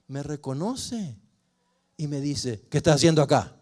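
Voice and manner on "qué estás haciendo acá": loud, angry yell; angry shout. Retold or imitated. Father yelling at his son who showed up, angry shout. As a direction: bark it at him, much louder. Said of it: "me estaba gritando, enojado".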